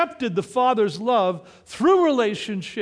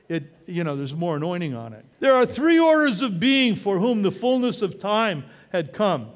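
ordinary talk

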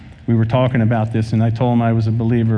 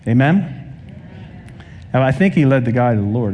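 normal talking voice

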